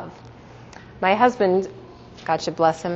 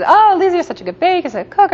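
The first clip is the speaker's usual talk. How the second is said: high, gushing praise from friends; 10 semitones higher, 4 dB louder, faster than usual. Retold or imitated. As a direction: lightly mimic admiring friends. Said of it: "other people would".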